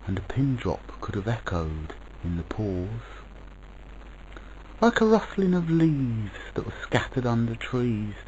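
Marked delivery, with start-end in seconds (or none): none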